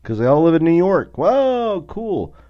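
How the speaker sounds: hammed-up sarcastic whoa, cool; mocking impressed New Yorkers; sarcastic mockery. Real, not acted.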